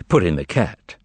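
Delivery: usual speaking voice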